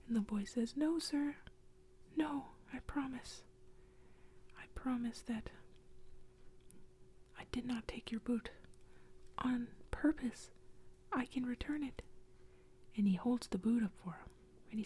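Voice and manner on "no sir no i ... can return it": lightly voiced boy, higher whisper; a hint of a nervous boy apologizing; frightened boy. Boy pleads with ghost.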